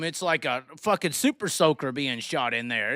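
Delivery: ordinary talk, nothing marked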